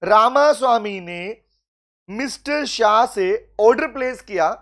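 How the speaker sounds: slow, deliberate reading with pauses between phrases; model question reading. Shown as a demonstration. Teacher showing his class how an exam question should be read, slow and measured. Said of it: "ऐसे पढ़ना होता है".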